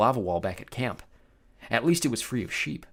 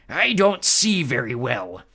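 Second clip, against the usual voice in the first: slow, loud monster growl; 7 dB louder, slower than usual. Acted. Polyphemus angry at being fooled, slow growl. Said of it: "he growled".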